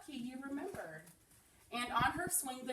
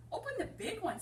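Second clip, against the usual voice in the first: put-on eager boy; 11 semitones higher than usual. A narrator's reading. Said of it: "Samson said".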